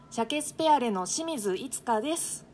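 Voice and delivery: ordinary talk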